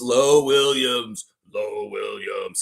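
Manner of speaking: "Low Williams" with a long drawn-out "Low" like "loh", slow; imitating Lou Williams. Host imitates how the player says his own name, slow and drawn out. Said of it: "He talks like that".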